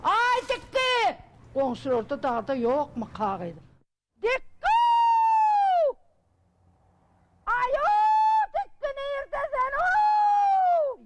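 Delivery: high, loud drawn-out hollering; long, loud rising calls; calling out. Acted. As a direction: holler across the yard for someone missing. Calling out for lost Dikki.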